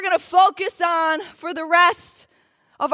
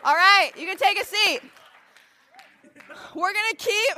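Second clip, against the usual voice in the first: usual speaking voice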